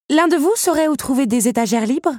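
high, loud question to the room; announcing to all. A narrator's reading. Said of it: "annonça-t-elle à la cantonade". (question lancée à la cantonade)

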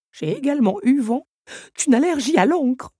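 high, loud alarmist voice; dramatic clock. A narrator's reading. Big Ben scaring the others, high and loud. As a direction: paint the worst case. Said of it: "insista Big Ben".

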